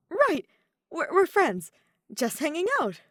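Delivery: high, loud, tripping over words; covering her feelings in a rush; flustered blurt. Acted. Yoshiko flustered telling Daya they're just friends, high and loud. Flustered after Daya's reassurance.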